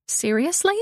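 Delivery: rising, disbelieving "Seriously?"; surprised question. Acted. Surprised question answered by echo.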